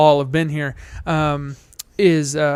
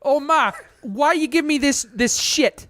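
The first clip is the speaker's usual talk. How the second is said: put-on higher, whiny East Coast kid to Ma; East Coast accent; 5 semitones higher than usual. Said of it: "we're terrible at this accent".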